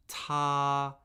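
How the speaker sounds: "Ta" held high and flat; first tone. Shown as a demonstration. Teacher modelling tones for repeating, "Ta" high and flat. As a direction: say "Ta" on a high, level, unchanging pitch. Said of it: "first tone, high, flat, and unchanging".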